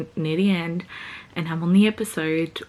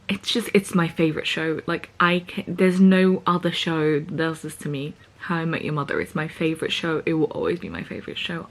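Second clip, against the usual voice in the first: ordinary talk, nothing marked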